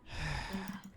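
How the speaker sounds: quiet, uncertain sigh; small hesitant sigh; soft sigh. Acted. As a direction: let out a soft breath before answering. Child character unsure what to do.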